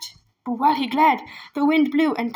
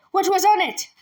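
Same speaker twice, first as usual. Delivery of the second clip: angry demand; 6 semitones higher, 3 dB louder than usual. Acted. Angry magistrate questions emissary.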